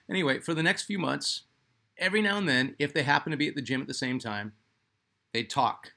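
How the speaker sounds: ordinary delivery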